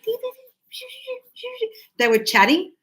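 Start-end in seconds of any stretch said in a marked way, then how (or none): 0.0-1.7 high soft mock bird chirps; lorikeet chatter. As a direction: chirp like little birds, pitched up. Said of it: "They were like".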